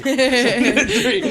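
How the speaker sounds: high, slow laughing words. Real, not acted. Laughing at burp.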